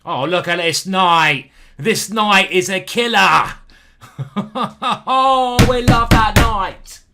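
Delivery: loud, high, drawn-out roar with laughter; exultant shouting. Real, not acted. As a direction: bellow in triumph, stretching the words. Celebrating a killer knight move.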